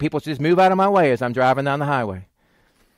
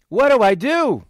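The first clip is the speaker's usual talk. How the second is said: raised, pleading question; acted dilemma; 6 semitones higher, 4 dB louder than usual. Churchgoer unsure after remembering the fight, pleading. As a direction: lift it high, at a loss.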